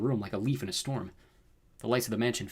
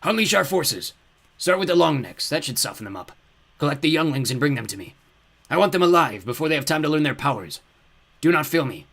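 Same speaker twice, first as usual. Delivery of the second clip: loud, raised villain orders; commanding fiery lord; 5 semitones higher, 7 dB louder than usual. A narrator's reading. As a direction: send out your army imperiously. Dark lord orders his henchman.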